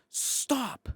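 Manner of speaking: high shouted stop; sharp high mock command; imitated yell. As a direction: yell it to halt the fight. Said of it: "She yells".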